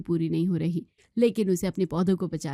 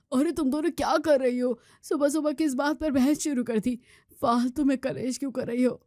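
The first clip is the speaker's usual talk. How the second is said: higher, exasperated husband; exasperated son; 5 semitones higher than usual. Acted. Son scolds quarrelling mother and wife, exasperated. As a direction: break up the morning quarrel.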